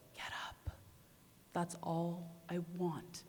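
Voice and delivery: soft, firm whisper, slow; tender urging whisper; whispered gentle command. Retold or imitated. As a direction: whisper it like someone kneeling right beside you. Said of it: "strong, but it was quiet".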